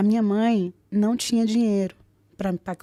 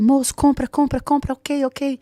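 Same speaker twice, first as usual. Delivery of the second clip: urgent excitement; 5 semitones higher, 5 dB louder, slower than usual. Retold or imitated. Urgent phone call, buy ticket.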